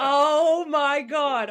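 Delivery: high, loud, very drawn-out gush; overjoyed exclamation. Retold or imitated. Teacher thrilled by class applauding, gushing exclamation. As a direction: stretch it out in theatrical delight.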